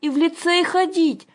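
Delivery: put-on very high, loud little-girl pipe; plaintive squeak. A narrator's reading. Younger daughter adds her protest, plaintive squeak. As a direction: squeak a childlike complaint. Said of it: "жалобно пискнула Аннушка".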